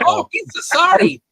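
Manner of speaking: high, loud alien goofball; mock Jar Jar. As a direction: squeal a teasing, exaggerated apology. Mimics Jar Jar to tease fan.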